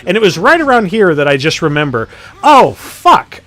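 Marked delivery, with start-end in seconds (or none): 2.4-3.4 high, loud retold outburst; alarmed realisation. Gamer just remembering the enemy's immunity, alarmed realisation. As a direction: re-enact the oh-no moment at full pitch. Retelling sudden realisation mid-fight.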